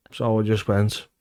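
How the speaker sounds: ordinary talk, nothing marked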